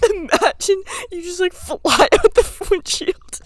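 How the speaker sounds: high, loud, slow mock amazement; goofy what-if voice. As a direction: drag out the absurd idea gleefully. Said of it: "and I was like".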